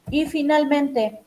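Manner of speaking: normal talking voice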